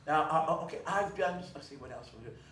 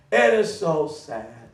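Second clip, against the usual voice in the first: usual speaking voice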